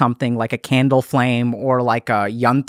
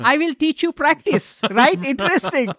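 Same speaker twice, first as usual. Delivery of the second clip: put-on high guru voice with Indian accent; playful Indian accent, much higher; 10 semitones higher than usual. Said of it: "Indian accent".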